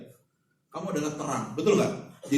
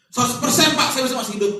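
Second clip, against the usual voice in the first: cocky reply; 7 semitones higher, 9 dB louder than usual. Pretend. Imagined confident listener reply.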